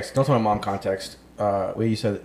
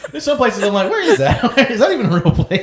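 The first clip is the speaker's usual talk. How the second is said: high, laughing disbelief; 7 dB louder than usual. Real, not acted. Host joking about obscure places, laughing through it. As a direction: talk through real laughter.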